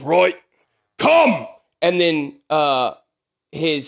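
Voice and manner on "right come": loud, higher barked order in character; Kong's command. Acted. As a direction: call out a commanding summons as Kong. Game master voices Kong calling out.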